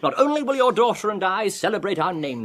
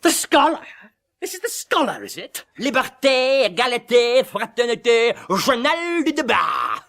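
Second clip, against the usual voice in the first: mocking; 6 semitones higher than usual. Foma mocks the scholar.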